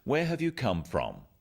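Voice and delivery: tune falling at the end on from. Shown as a demonstration. Teacher showing the falling tune on a wh-question. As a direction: let where have you come from fall, friendly. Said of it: "Notice also the falling tone".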